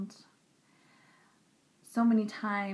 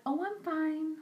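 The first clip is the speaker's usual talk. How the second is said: brushing-off friend; 9 semitones higher than usual. Retold or imitated. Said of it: "she's just like".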